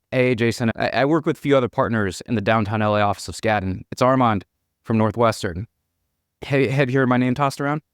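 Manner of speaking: usual speaking voice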